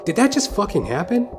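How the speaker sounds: usual speaking voice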